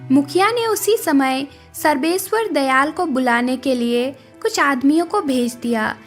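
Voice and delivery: ordinary delivery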